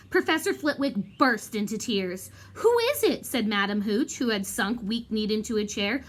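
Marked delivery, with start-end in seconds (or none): 2.5-3.3 much higher, breathless alarm; shaken. Acted. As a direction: dread in the question. Hooch weak-kneed, shocked.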